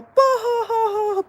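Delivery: loud, high burst of cackling laughter; mocking laugh. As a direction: roar with laughter like the boyfriend did. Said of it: "busting his, busting up".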